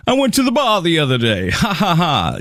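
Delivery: self-amused guy, lightly hammed; cheesy guy laughing at himself. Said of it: "if a guy is sitting around".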